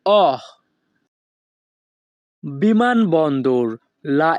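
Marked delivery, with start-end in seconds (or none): 0.1-0.4 a clear open "অহ" sound, held briefly. Shown as a demonstration. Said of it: "এখানে ও এর উচ্চারণ হবে".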